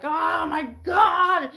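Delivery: high, slow, overwrought mock wail; hormonal woman. Mocking a woman on her period, high slow wail. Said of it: "they're just like".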